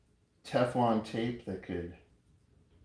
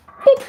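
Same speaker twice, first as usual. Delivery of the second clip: very high, loud little boop; 17 dB louder than usual. Shown as a demonstration. Coin maker showing how the press goes, high squeaky boop.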